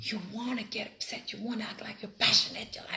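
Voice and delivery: breathy whisper. Shown as a demonstration. Whispering to students about faking anger. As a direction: whisper it like a confession.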